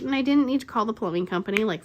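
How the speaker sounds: normal talking voice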